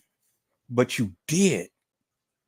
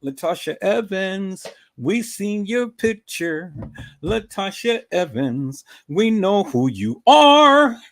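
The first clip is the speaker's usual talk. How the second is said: slow, drawn-out gloating call; teasing taunt; 4 semitones higher, 4 dB louder than usual. Real, not acted. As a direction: stretch it out, swelling loud at the end. Teasing call to exposed rival.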